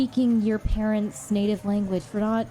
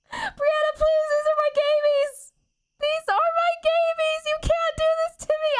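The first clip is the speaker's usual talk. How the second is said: shrieking wail; slower than usual. Acted. Begging Brianna to spare her gabies, high shrieking wail. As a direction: wail desperately for your babies.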